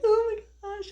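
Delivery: high, loud burst of joy; retold excited gasp. Parents seeing their newborn for the first time, excited squeal. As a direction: squeal it like you just saw your baby.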